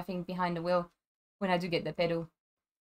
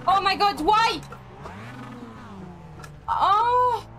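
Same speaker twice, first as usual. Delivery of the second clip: frustrated outcry; 7 semitones higher, slower than usual. Real, not acted. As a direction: cry out in disbelief. Car won't go into gear.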